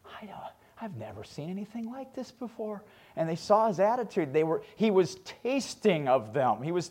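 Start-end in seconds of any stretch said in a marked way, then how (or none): 0.1-2.8 hushed, breathless amazement in a whisper; whispered wonder of a jailer; awed whisper. Retold or imitated. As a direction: whisper it as if stunned by what you see. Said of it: "he goes".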